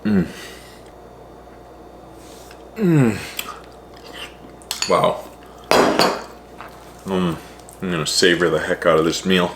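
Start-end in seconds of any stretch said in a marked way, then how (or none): 0.1-7.4 savoring delicious food, moaning between bites; drawn-out mm of pure enjoyment. Real, not acted. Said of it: "Food moan".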